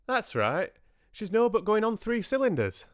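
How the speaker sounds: high, emphatic dialect voice; broad country speech, pitched right up; rustic farmer. A narrator's reading. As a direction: explain it like a hearty farmer.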